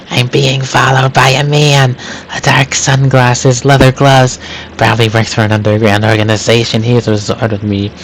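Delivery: ordinary delivery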